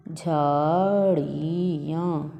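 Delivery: झाड़ियाँ stretched very slowly; very slow teaching read of झाड़ियाँ; drawn-out word. Shown as a demonstration. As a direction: drag झाड़ियाँ out for the class.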